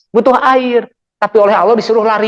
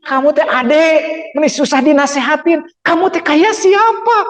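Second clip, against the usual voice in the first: mock angry mother; 7 semitones higher than usual. A mother scolding her hard-to-advise child, mock nagging. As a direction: play an exasperated mom scolding. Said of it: "Ibu marah ke anak".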